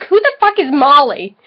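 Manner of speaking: ordinary delivery, nothing marked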